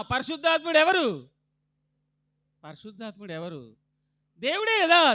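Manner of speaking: high, loud preacher questions; rousing sermon call; preaching call and response. Acted. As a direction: drive the church to answer, loud and high.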